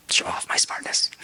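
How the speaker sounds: hushed, voiceless confession; whisper. Real, not acted. Speaker admits liking to look clever, confiding whisper. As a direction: drop into a playful whisper.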